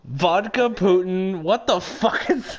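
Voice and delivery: slow, loud mock Russian; mock Russian accent. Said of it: "Oh no, it's another Russian!".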